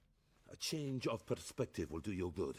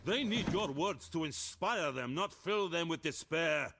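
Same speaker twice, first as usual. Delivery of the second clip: higher, raised voice in a quarrel; heated argument; 8 semitones higher than usual. Acted. Officers clashing over telling the truth about the war, heated. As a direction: argue it out with rising force, pitched up.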